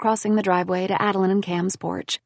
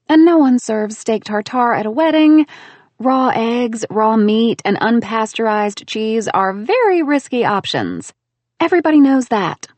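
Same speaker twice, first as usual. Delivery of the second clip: louder, prim know-it-all; brisk scolding lecture; lecturing Bobby; 3 semitones higher, 7 dB louder than usual. Acted. Bobby scolds the bride, loud prim lecture.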